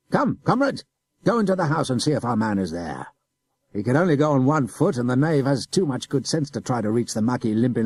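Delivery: loud officer cursing and giving orders; angry adjutant. A narrator's reading. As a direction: bark commands at the soldiers. Adjutant curses, orders men.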